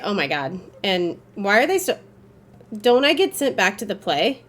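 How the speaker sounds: ordinary talk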